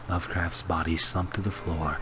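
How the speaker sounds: usual speaking voice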